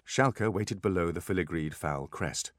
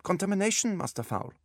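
put-on high-pitched German accent, no apology; brusque German-accented refusal; unsympathetic German doctor. A narrator's reading. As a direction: warn him off in a German accent. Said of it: "without a trace of apology or sympathy".

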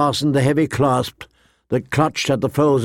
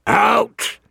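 much higher choked furious shout; croaking rage; 10 semitones higher than usual. Acted. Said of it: "he croaked, choking on his rage".

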